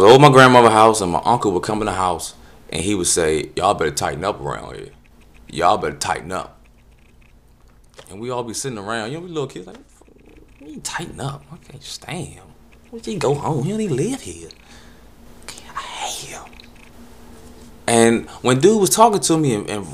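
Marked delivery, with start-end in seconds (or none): 3.6-6.6 lower, gruff-sounding warning; a hint of a stern uncle. Uncle scolding the kids at grandma's, low. 10.6-16.4 softer, grumbling under the breath; muttering kids. Retold or imitated. Little kids sulking about the uncle, muttered.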